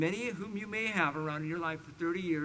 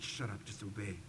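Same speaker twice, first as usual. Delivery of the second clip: hushed order; 10 semitones lower, 11 dB quieter than usual. Retold or imitated. Brother hissed at during altar call.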